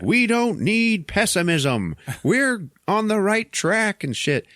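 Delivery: high, slow podium oratory; politician's speech. Retold or imitated. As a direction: proclaim it slowly like a campaign speech. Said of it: "there's a politician talking".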